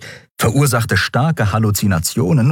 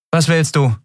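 hostile; 8 semitones higher than usual. Acted. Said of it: "Feindselig zog sie die Augenbrauen".

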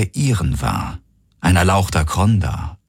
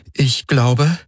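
higher, tentative voice left hanging; hesitant beginning; 7 semitones higher than usual. A narrator's reading. Kirai starting a thought about the ship, hesitant and high. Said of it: "begann sie".

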